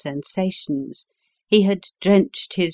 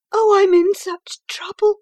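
much higher, louder, pleading and distraught; frightened wife begging her friend for help; crying out; 7 dB louder than usual. A narrator's reading. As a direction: cry it out, close to tears. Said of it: "she cried".

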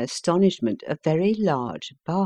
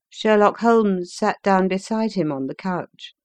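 plain reading voice

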